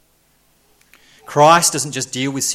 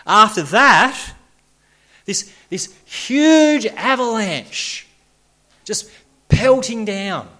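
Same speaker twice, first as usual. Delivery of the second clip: higher, louder and slow, picturing the huge wave; intense preaching; 9 semitones higher, 5 dB louder, slower than usual. Acted. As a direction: paint the avalanche with rising passion. Avalanche image preached intensely.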